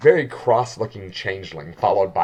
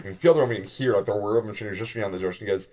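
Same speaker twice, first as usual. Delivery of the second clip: normal talking voice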